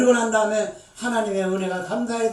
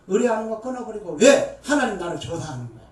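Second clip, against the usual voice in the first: ordinary talk, nothing marked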